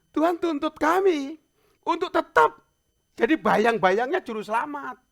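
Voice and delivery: high, loud, ringing preacher's delivery; preaching at full force. Acted. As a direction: drive the charge home, loud and high.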